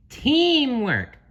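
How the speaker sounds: "Teamwork" very high, long "tee"; correct pronunciation. Acted. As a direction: insist on "Teamwork", loud and high. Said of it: "gently corrected him".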